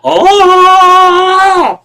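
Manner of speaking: very high, loud, long held rock scream. Retold or imitated. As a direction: wail out a sustained rock-singer shriek. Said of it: "come flying in with the Led Zeppelin".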